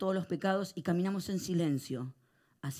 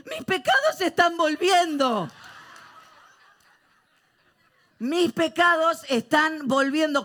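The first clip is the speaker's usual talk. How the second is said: put-on panicked boy; 12 semitones higher, 11 dB louder, slower than usual. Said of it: "uno de los chicos levanta la cabeza y dice".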